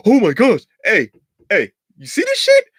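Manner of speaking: high, squealing mock gasp at the screen; mocking gasping moviegoer. Mocking a chatty moviegoer, high squealing gush. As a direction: ham up a gushing stranger in the seat next to you.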